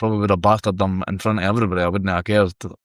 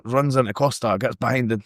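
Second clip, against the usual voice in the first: ordinary talk, nothing marked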